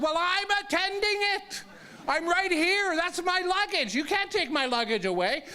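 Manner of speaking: high-pitched indignant comeback; acted-out squeal of exasperation; exasperated protest. Acted quarrel with guard over luggage.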